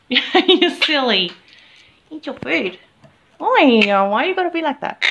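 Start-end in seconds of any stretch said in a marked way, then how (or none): 0.1-1.3 slow, high, fond teasing of a pet; pet talk. Real, not acted. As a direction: coo it to the bird.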